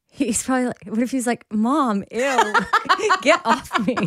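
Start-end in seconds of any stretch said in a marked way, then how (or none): 1.5-4.0 higher, laughing mock recoil; mock disgust. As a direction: play grossed-out, breaking into a laugh. Imagines sleeper recoiling from his mother.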